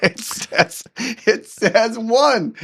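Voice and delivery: laughing through the punchline, high. Real, not acted. Storyteller reveals his reset streak to host, laughing.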